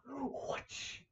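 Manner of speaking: breathy swoosh of a blade; soft whoosh effect; whispered whoosh. Retold or imitated. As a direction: mouth sound of a fast slash. Sword swoosh sound effect.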